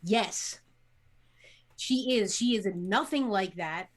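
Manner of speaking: usual speaking voice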